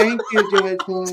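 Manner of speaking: lightly hammed-up drunken gratitude; drunk Pelosi mockery. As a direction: imitate a boozy politician giving thanks. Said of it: "completely drunk off of a box wine".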